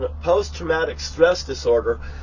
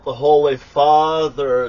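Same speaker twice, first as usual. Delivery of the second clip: normal talking voice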